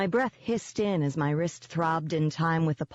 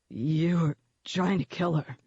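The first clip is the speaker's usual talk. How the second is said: voice cracking. Acted. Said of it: "his voice cracking".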